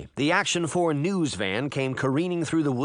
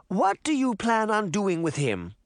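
higher-pitched grandmotherly question; matter-of-fact granny; 8 semitones higher than usual. A narrator's reading. Grandmother questioning the giant about Jack, matter-of-fact. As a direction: ask the giant as if it were ordinary. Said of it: "as if she were talking to an ordinary person".

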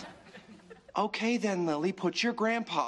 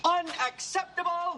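outraged; 13 semitones higher, slower than usual. Acted. Outraged outburst on phone.

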